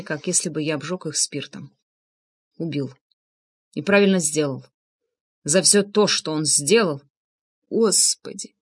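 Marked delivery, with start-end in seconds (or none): 2.6-7.0 low, drained admission; weak voice. A narrator's reading. Narrator in shock after shooting Влад, weak voice. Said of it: "Голос был слабым, связки болели". 7.7-8.6 soft, grieving moan; quiet lament. A narrator's reading. Анна crawling to Влад's body, quiet lament. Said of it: "тихо запричитала Анна".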